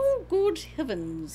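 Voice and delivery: put-on high, slow, prim old lady; a fluttery old lady gasping. Shown as a demonstration. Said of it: "Do your old lady one".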